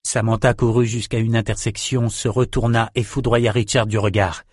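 plain reading voice

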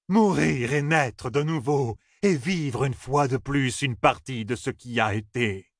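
slow, high, chanting prophecy; proclaiming oracle. A narrator's reading. Second oracle answering Matt, slow proclaimed prophecy. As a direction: intone the fate high and drawn out. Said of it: "lui cria cette réponse".

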